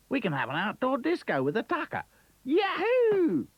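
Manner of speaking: high, whooping Aussie voice; bouncy kangaroo cheering in Australian accent. Acted. Kangaroo character voice.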